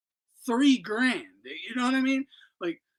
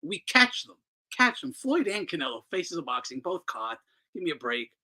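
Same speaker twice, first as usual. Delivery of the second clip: ordinary talk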